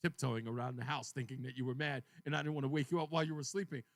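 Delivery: low, downcast retold explanation; subdued, dark mood. Husband explains tiptoeing around wife, subdued. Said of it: "kind of in a dark place".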